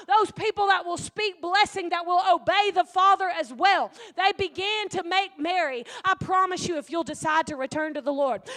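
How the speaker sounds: loud, driving sermon delivery; fiery preacher building to a shout; preaching. Acted. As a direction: preach it with full force. Preaching at full force.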